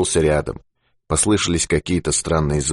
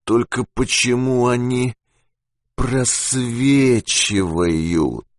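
slow, drawn-out, weary murmur; despondent, very slow; dejected; 5 semitones higher, much slower than usual. A narrator's reading. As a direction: trail off hopelessly. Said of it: "уныло проговорил он".

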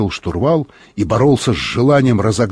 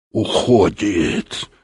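slow, lower, through gritted teeth; 4 semitones lower, much slower than usual. A narrator's reading. Explorer watches the fragment escape, speaking through his teeth. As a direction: drag it out through clenched teeth. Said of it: "сказал Юрковский сквозь зубы".

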